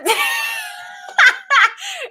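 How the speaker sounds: very high squealing burst of laughter; helpless high shrieking laugh. Real, not acted. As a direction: lose it completely in a high shriek. Cracks up over a word.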